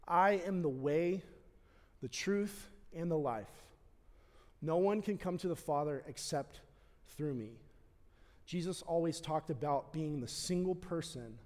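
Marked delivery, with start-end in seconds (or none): 0.1-7.5 quiet quoted scripture. Acted. Pastor softly quoting Jesus to the congregation, reverent and quiet. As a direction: hush the room for the words.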